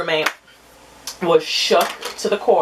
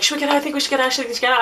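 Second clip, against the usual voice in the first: nervous roommate; 8 semitones higher, much faster than usual. Retold or imitated. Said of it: "Her room was like".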